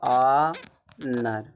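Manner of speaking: ऑनर drawn out very slowly in two parts, vowel first; sounded-out, silent H. Shown as a demonstration. Teacher showing how honor is read, slow syllable-by-syllable ऑनर. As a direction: stretch ऑनर into ऑ and नर, no H. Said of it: "इसको हम ऑनर पढ़ते हैं".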